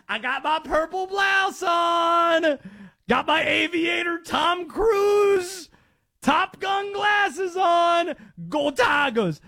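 high, whooping mock Southern official; Liz Morrell. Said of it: "that is the attorney general".

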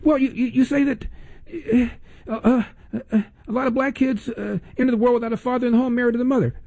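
high-pitched mock floundering; hesitant caller. Host imitates caller groping for an example, mock floundering. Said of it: "can you give me an example?".

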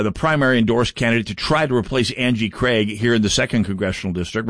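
normal talking voice

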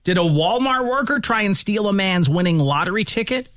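high-pitched teaser question; radio host teasing the next segment. Acted. Radio tease of coming stories.

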